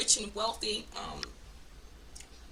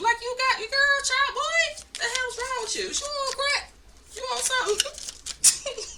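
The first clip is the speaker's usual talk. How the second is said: incredulous sass; 6 dB louder than usual. Acted. Exasperated comeback to the abusers.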